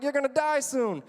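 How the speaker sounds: high, loud comeback; heated retort. Retold or imitated. Student to old teacher after being expelled, heated retort. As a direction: fire it back loudly.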